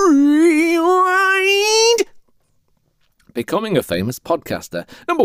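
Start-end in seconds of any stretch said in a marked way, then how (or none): 0.1-2.0 long, high stretched-out word; cartoon rewinding sound effect; rewind noise. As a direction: trill and stretch it like tape spooling back. Mimics tape rewinding.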